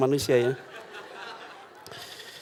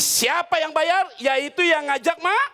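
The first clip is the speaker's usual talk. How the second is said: much higher, slowed point-making; slow, emphatic; 11 semitones higher, slower than usual. Real, not acted. Preacher hammering home who should pay, slow and high. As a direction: spell out who pays, slowly and high.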